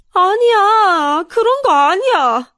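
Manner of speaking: high, loud, drawn-out sobbing protest; acted bawling, stretched words; wailing denial. A narrator's reading. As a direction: wail it slowly through tears. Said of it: "저는 남편한테 안겨서 한참 울었어요".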